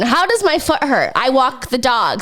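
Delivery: normal talking voice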